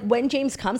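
ordinary talk

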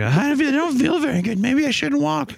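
high whiny cartoon kid; high sickly Buster. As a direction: whine it like a sick kid. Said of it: "Buster's like".

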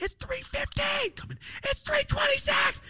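shrill time update from an annoyed mom; mom announcing the time. Retold or imitated. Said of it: "she would come in and say".

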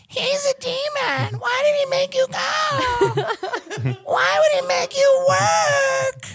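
very high, loud squealing mock wife; mock outraged wife. Husband's wife shrieking in sympathy, high hammed-up falsetto.

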